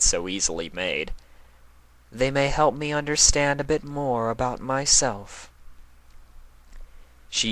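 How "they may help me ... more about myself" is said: lightly voiced Luna, much higher; considering. A narrator's reading. Luna considering what the villains teach her, lightly voiced. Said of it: "she considered".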